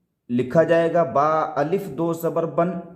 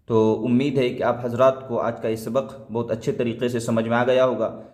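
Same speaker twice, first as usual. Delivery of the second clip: ordinary delivery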